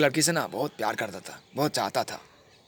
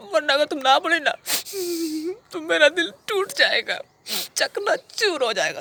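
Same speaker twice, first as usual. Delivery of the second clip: high-pitched, crying plea; tearful begging. Acted. Lover begs Rani not to say no, high tearful whine. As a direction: wail it as if heartbreak is coming.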